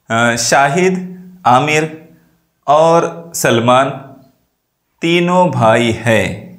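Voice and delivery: slow list with a short pause after "Shahid" and "Aamir"; brief comma pauses. Shown as a demonstration. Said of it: "थोड़ी देर के लिए तौकुफ़ किया".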